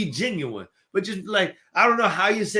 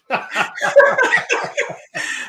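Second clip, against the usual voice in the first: airy, high burst of laughter; breathy high laugh; 5 dB louder than usual. Real, not acted. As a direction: laugh out loud, delighted. Laughs after upbeat affirmation.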